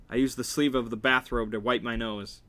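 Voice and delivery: plain reading voice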